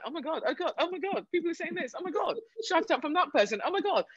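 replaying her frantic scrolling, squealing in disbelief; imitating her own panic. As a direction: gush like you can't believe what you're reading. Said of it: "I was like".

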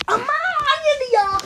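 a high, dragged-out whine at the game; whiny protest. Real, not acted. Character fails, whining protest.

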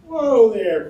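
high, loud alarmed holler; cried out. A narrator's reading. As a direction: holler to halt a fight. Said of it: "cried Owens as he ran up".

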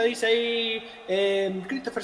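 usual speaking voice

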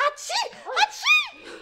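rising, loud hammed-up sneeze; fake sneeze. Daughter fake-sneezing to interrupt, hammed up. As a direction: sneeze big and theatrical.

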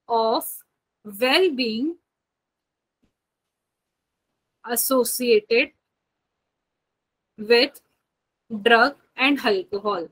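slow, spaced-out dictation with long pauses. Acted. Teacher dictating a definition to her students, slow and spaced. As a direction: spell the definition out word by word for note-taking.

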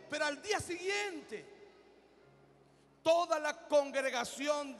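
preacher driving the story home; impassioned preaching. Acted. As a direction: keep pressing the point from the pulpit.

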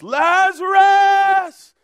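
long drawn-out, high shout; loud cry. Acted. Jesus calling the dead man out of the tomb, loud cry. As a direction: call out at full voice, stretching the name. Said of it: "cries out in a loud voice".

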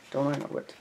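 ordinary delivery, nothing marked

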